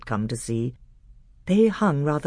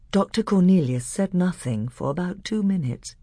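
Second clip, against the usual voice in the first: ordinary delivery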